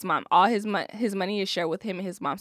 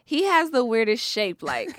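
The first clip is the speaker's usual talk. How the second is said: normal talking voice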